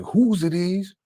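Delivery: mock the fiance's shrill demand; fiance's outraged demand. Fiance confronting Bobby over the drawers, accusing. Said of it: "And she's like".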